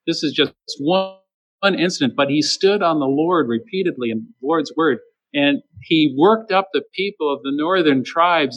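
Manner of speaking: ordinary talk, nothing marked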